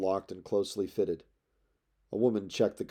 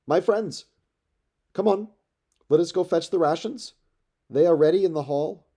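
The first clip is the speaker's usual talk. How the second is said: high, loud rallying call to the crew; young leader shouting orders, louder and higher; 8 semitones higher, 8 dB louder than usual. Acted. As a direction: call across the beach to his men. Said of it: "Telemachus called out".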